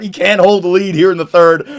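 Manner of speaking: usual speaking voice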